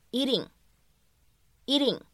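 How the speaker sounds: "eating" with the T as a quick flap; flapped T. Shown as a demonstration. Teacher models American pronunciation, "eating" with a flap T. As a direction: say "eating" slowly, American tapped T in the middle. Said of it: "比较典型的flap T，闪音的处理".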